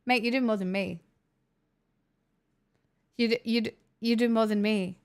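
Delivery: ordinary talk, nothing marked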